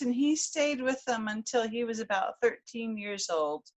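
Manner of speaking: ordinary delivery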